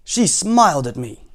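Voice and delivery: stretched-out indignant soldier's complaint; accusing soldier. Acted. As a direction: report it as a scandalized soldier. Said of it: "said the soldier".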